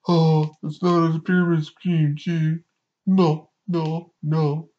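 high, huffy mocking voice; huffing-and-puffing mock protest. Greek God X huffily denying a pyramid scheme, mocking high voice. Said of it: "comes in with the huffing and puffing".

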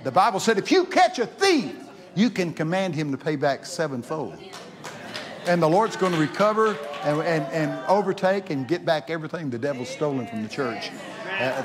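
loud, high, fervent preaching. Acted. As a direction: build the sermon to a loud fervent peak. Preaching at full force.

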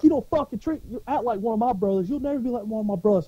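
high, worked-up scolding; retold angry tirade. Marine berates a fake Marine, retold outrage. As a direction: act out indignant cussing at normal volume. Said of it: "I'm screaming, I'm cussing".

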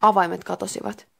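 ordinary delivery, nothing marked

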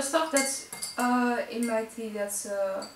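speaking between bites; chewing while talking. Real, not acted. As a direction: mouth partly full. Seen 0: Bite taken at -2.0; jaw moving with food while speaking.

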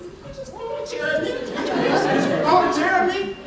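can't believe what just happened; shocked disbelief. Retold or imitated. Retelling a chair thrown at him.

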